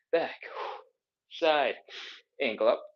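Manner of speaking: usual speaking voice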